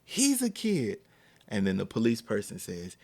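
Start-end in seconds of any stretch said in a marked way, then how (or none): 0.0-1.0 high, insistent mock protest; retold plea pitched way up; aggressively compelling. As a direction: imitate a mom arguing for a child. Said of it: "just aggressively compelling argument".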